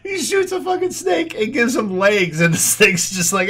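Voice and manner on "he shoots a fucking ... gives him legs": ordinary delivery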